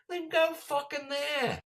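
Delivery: slow, very high, loud mock yell; drawn-out exasperated shout; furious heckler imitation. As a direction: bellow it like a man out of patience. Imitating fed-up heckler yelling.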